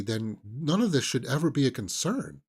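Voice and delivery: ordinary talk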